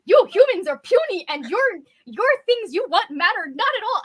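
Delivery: normal talking voice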